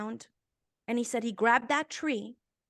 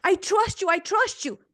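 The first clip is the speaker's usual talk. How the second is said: higher, louder, eager "I trust you"; urgent pledge; 7 semitones higher, 4 dB louder than usual. Retold or imitated. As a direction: blurt it out pleading. Father answering God in tears.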